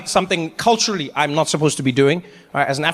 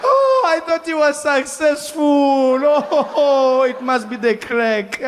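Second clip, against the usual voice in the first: higher, slow, dismayed grandmother; 6 semitones higher, much slower than usual. Retold or imitated. Grandmother shocked to find Trevor camping, wailing dismay. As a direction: wail it like a shocked granny. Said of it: "She'd be like".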